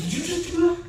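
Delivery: high, laughing disbelief at what he just saw; an incredulous, half-laughing cry; shocked disbelief. Acted. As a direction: gasp it out in amazement. [name] shocked at the hole.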